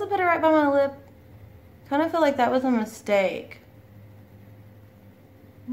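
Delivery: talking while applying face mask; finger on lip. Real, not acted.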